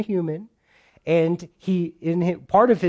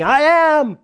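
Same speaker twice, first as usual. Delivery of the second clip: mock proclamation; 10 semitones higher, 6 dB louder than usual. Mock stranger saying it on street.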